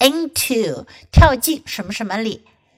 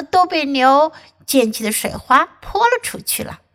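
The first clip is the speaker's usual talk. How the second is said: ordinary talk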